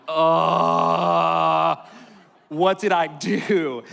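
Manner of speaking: hammed-up regret; mock dread. Teen pastor realizing his lie, mock dread. Panicked thought, retold.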